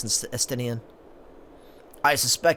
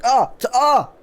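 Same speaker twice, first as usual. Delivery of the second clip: high yelping cries; startled yelp; 12 semitones higher, 7 dB louder, slower than usual. Retold or imitated. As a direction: yelp as the tie slaps your face. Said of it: "and he's like".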